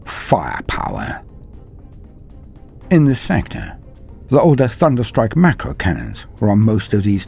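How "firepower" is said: ordinary delivery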